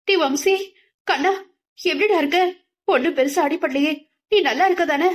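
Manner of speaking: high, tearful pleading of a mother; a mother sobbing out her worry for her son; crying worried mother. A narrator's reading. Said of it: "அன்னையின் அழுகையுடன் கூடிய கவலை குரலில்". (அழுகையுடன் கவலையான அம்மா குரல்)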